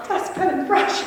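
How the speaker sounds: high, laughing repeat of Dustpan and brush. Real, not acted. Recalling Rose with a dustpan, laughing hard. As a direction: crack up at the absurd tool.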